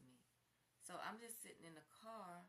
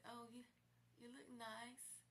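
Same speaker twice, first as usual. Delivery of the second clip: higher, slow and choked; weepy, slow compliment; tearful; 7 semitones higher, slower than usual. Real, not acted. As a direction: say it through tears. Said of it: "I'm in tears saying this".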